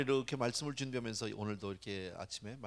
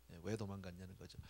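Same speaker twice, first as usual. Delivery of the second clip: ordinary talk, nothing marked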